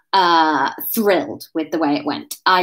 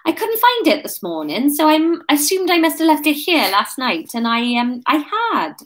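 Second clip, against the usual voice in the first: put-on higher, halting cover story in Welsh accent; flustered, pitched-up explanation; thinking quickly; 8 semitones higher than usual. A narrator's reading. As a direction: improvise an excuse as you go. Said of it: "thinking quickly".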